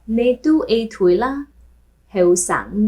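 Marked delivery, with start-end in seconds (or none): none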